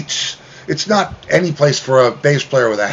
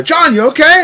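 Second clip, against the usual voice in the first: imitating Howard; 14 semitones higher, 6 dB louder than usual. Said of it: "So he says to John".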